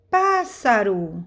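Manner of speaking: "Pássaro" said slowly, opening "Pás" held and stressed; stress on first syllable. Shown as a demonstration. Teacher introducing an example word, "Pássaro" slow with stressed PÁS. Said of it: "e a sílaba forte é".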